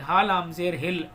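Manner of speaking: usual speaking voice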